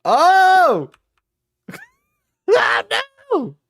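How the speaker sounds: high loud shocked cry; shocked yelp. Real, not acted. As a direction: yelp at the screen. Shocked by lewd clip.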